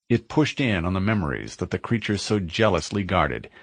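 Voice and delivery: usual speaking voice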